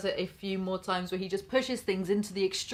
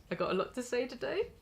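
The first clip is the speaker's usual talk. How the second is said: ordinary delivery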